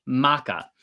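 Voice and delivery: "maka" said as "MAH-kah", stress on the first syllable, falling; careful vocab word. Shown as a demonstration. Host teaching a Hawaiian word before the story, careful pronunciation. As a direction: give "maka" clearly for learners. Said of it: "And then finally".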